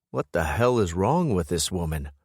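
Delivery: ordinary talk, nothing marked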